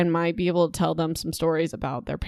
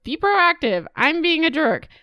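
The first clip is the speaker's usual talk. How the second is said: much higher, mock-pompous father; mocking dad voice; 9 semitones higher than usual. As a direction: parody the dad, high and smug. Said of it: "So her dad's like".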